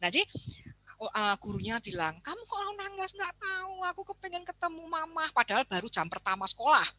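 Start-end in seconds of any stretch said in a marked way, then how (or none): 2.2-3.1 high, soft teacher's question; teacher asking. Retold or imitated. Teacher asking crying child. 3.1-5.3 put-on high, soft little child's voice; whiny child. Child answers teacher.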